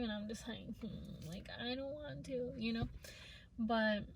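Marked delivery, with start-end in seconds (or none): 0.8-2.5 soft, hesitant mumbled protest; quiet reluctance. Retold or imitated. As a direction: voice a small, reluctant refusal, much softer. Voicing own reluctance to partner.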